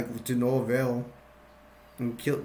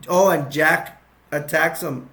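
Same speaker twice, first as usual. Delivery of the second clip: ordinary talk, nothing marked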